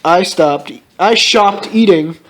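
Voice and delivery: ordinary delivery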